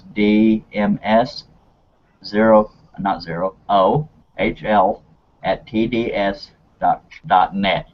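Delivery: very slow, letter-by-letter dictation; saying it slowly. Real, not acted. Host spelling an email address for viewers, slowly and deliberately. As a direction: spell it out with gaps for note-takers. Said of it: "I will say this slowly so people can write it down".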